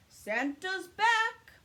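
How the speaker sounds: big, slow, booming Santa call; jolly Santa announcing he came back; Santa crying out. A narrator's reading. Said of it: "he cries".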